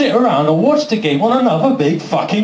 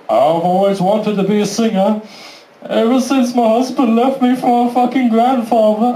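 put-on higher, plaintive contestant voice; mock sob story; 4 semitones higher than usual. Talent show contestant unloading on the judges, mock-plaintive. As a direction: pour out a hammed-up tale of woe.

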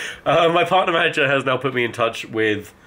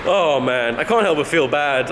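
ordinary talk, nothing marked

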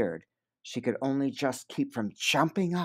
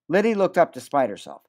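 ordinary delivery

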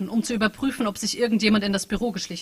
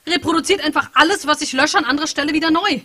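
frustrated rant; 7 semitones higher, 8 dB louder than usual. Acted. Bug program ranting about its code.

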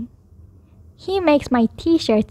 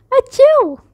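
high, loud acted sneeze; sneezing; 11 semitones higher, 6 dB louder than usual. A narrator's reading. Child sneezing in Bear's snow, acted sneeze. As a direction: burst out with a storybook sneeze. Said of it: "I sneezed".

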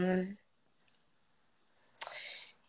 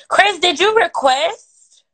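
calling out; 6 semitones higher, 8 dB louder, slower than usual. Real, not acted. Calls out to viewer Chris.

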